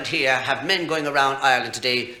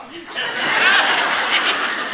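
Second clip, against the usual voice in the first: breathy laugh. Real, not acted. Laughs after punchline.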